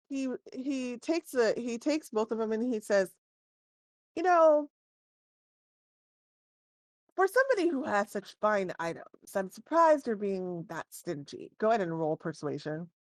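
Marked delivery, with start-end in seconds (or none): 4.2-11.5 shopkeeper voice. Acted. Shopkeeper teasing the party over their haggling, lightly voiced. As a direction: needle the buyer for being stingy.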